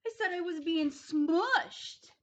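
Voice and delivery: high, loud whining protest; whiny complaint. Acted. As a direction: complain loudly like a squashed kid. Child complaining, louder.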